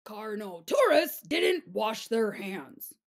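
ordinary delivery, nothing marked

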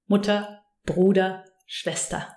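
"Mutter, Bruder, Schwester" each ending in a light a; unpronounced final R. Shown as a demonstration. Teacher listing words that end in ER, final R dropped. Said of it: "Any word that ends in E R".

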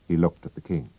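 ordinary delivery, nothing marked

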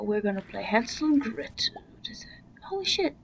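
hushed whisper to the audience; breathy game-night whisper. Real, not acted. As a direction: whisper it like a secret. Whispering while gaming.

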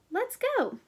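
little dinosaur eager to help; eager high voice. A narrator's reading. Character eager to go.

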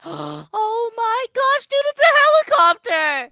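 high, loud gasping shout; excited shout. Real, not acted. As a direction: yell it out in amazement. Spotting the helicopter.

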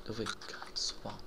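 unvoiced whispered question; whispering. Real, not acted. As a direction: whisper while hiding. Sneaking past enemy.

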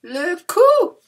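"Le cou" sung out high and loud; high sing-song "Le cou"; high teacher call. Shown as a demonstration. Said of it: "very easy to remember".

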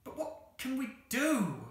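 high, drawn-out helpless question; mild childlike wail, slow and high. Acted. Jane asks her sister in despair, helpless wail.

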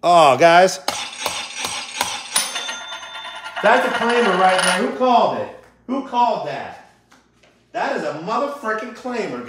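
high excited shriek over a big win; yelling with real jackpot joy; shrieking jackpot winner. Real, not acted. Big win reveal on stream.

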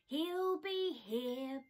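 slow, smiling reassurance. Acted. Bear reassuring his doubting friends. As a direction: say it with a smile. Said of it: "Bear smiled".

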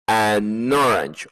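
"an orange" said slowly with the n joined on; linked an orange. Shown as a demonstration. Teacher models for students, "an orange" linked and slow. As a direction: glide "an orange" as one word, slowly. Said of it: "la N se pegara a la palabra".

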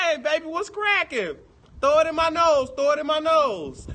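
high, loud playful holler; loud mock-excited whoop; rowdy clowning. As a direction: holler it out like a party joke. Clowning loudly about drugs on stream.

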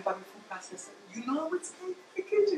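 soft start rising to a high coo; talking to appliance. Pretend. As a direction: playfully address a pretend pet gadget. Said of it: "And I say to him".

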